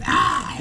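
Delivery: breathless, unvoiced crazy noise; whispered strangled ah. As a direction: push out an airy strangled ah. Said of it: "he make this noise".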